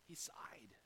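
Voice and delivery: "He sighed" breathed out as a soft whisper. Acted. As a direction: let "He sighed" fall away in a hushed breath. Preacher enacts Jesus' sigh.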